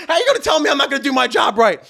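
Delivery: high indignant challenge; freaking out. Retold or imitated. DJ challenging the coordinator over his job, indignant freak-out. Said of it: "I started freaking out".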